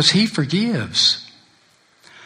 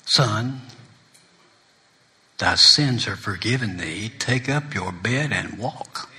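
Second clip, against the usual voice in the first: soft, slow, lower forgiving words; tender Jesus voice; 4 semitones lower, 5 dB quieter, slower than usual. Acted. Jesus forgiving the sinner, soft and slow. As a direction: hush it down like a gentle blessing.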